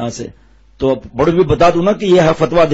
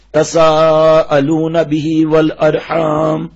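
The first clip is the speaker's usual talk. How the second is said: slow Quranic recitation; much slower than usual. Acted. The preacher quotes the imam's nikah verse, slow recitation. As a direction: draw the verse out very slowly in a chanting tone.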